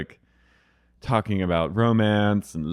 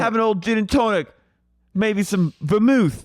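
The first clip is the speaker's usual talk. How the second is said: hammed-up Cockney; 11 semitones higher than usual. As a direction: push the drink in a put-on Cockney lilt. Charlotte's Cockney voice continues.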